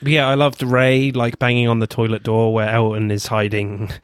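usual speaking voice

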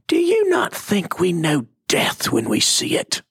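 a mock British cartoon lady challenging Jesus; a light British accent from a Bible cartoon. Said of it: "she had a British accent".

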